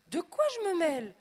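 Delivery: high, loud, offended snap; indignant outburst. A narrator's reading. Guest snapping at her host, offended outburst. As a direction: bristle at the rude question. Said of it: "S'emporte madame du Pressoir".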